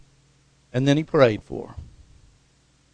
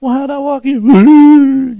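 very high, loud, slow mock-innocent question; sassy old mother; 10 semitones higher, 12 dB louder, much slower than usual. Healed mother answering the preacher, slow, high and loud. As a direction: draw it out slowly, cheeky and loud.

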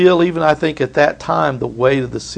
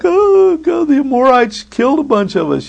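mock whining; 12 semitones higher than usual. Congregation whining to God.

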